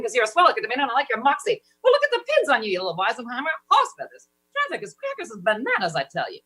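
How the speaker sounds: 1930s screen siren, high and quick; femme fatale. Pretend. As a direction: purr it like a 1930s movie vamp. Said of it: "sounded like a femme fatale from the nineteen thirties".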